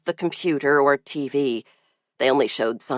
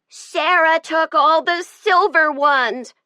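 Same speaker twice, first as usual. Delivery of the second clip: put-on slow, high, tearful little girl's outburst; furious child; 11 semitones higher, 4 dB louder, slower than usual. A narrator's reading. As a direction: wail it like a crying, furious small child. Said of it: "Zoe was furious".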